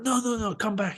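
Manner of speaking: put-on high, lightly French-accented scolding. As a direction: imitate a fussy French official. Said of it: "He was French".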